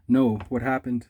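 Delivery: ordinary talk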